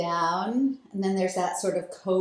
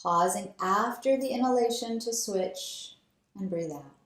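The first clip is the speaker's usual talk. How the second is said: guided breathing meditation. Acted. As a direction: lead them gently through each breath. Leads alternate nostril breathing.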